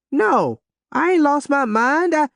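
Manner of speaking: put-on high, pleading young girl; protesting teen girl. A narrator's reading. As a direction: argue back like a teenager. Daughter protests to parents.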